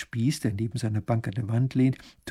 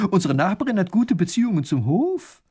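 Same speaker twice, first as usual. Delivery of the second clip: high-pitched, insistent claim; agitated insistence; 7 semitones higher, 4 dB louder than usual. A narrator's reading. Wife defending the rumour to her husband, agitated. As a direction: press the point excitedly. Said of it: "wird die Frau noch aufgeregter".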